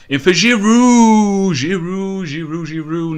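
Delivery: high, stretched shout that gradually eases; long celebratory commentator yell, tapering off; shouted goal call. Real, not acted. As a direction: bellow the scorer's name, drawing it out.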